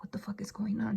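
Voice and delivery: hushed whisper while hiding; whispered fear. Retold or imitated. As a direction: whisper it, crouched and scared. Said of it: "I was just hunched".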